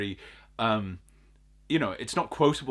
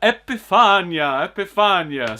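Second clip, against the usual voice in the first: higher, louder, drawn-out "Epifania"; Nosferatu impression; 6 semitones higher, 8 dB louder than usual. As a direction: stretch out "Epifania" like a ghoul. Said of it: "my Nosferatu impression".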